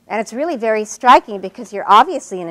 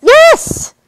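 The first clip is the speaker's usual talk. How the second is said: very high, loud burst; emphatic exclamation; 13 dB louder than usual. Acted. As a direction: blurt it out as an emphatic yes. Teacher emphatic agreement.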